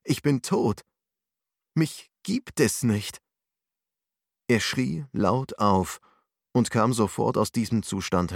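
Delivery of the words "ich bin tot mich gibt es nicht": fearful. A narrator's reading. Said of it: "vermutete er mit Furcht".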